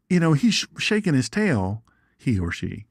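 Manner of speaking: ordinary talk, nothing marked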